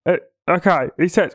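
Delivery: usual speaking voice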